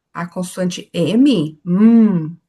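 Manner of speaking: M hummed as a bare consonant, no vowel; slow teacher demo of the pure M sound. Shown as a demonstration. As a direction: hold a closed-lip M. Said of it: "treinar a pronúncia pura das consoantes".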